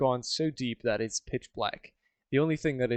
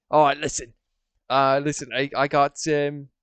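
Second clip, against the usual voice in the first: louder attempt at a British accent; 3 semitones higher, 7 dB louder than usual. Shown as a demonstration. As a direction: try out a British accent, haltingly. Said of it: "no, that's British".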